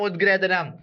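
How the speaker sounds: ഉദ്ഗ്രഥനം with a t-like d sound before the g; correct d sound. Shown as a demonstration. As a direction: say ഉദ്ഗ്രഥനം as ud-grathanam. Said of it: "ഉദ്ഗ്രഥനമാണ്".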